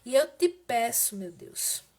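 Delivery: subdued, measured prayer with pauses; intercessory prayer. Real, not acted. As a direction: plead gently with God for someone ill. (oração de intercessão, serena e contida)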